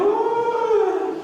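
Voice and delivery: long high ghostly "uuuh"; imitated ghost wail. Preacher mimicking spooks at a Halloween fair, high mock ghost wail. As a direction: hoot like a fairground ghost, drawn out and high. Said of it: "se meten y están haciendo".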